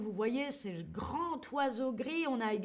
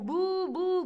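high, loud jeering boos; mock booing; 7 semitones higher, 5 dB louder than usual. Shown as a demonstration. Teacher boos to show how people jeer. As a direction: boo like a hostile crowd. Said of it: "pousser des cris de dérision".

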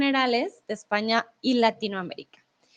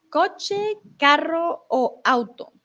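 slow listing; 4 semitones higher, slower than usual. Shown as a demonstration. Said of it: "teacher reads options slowly".

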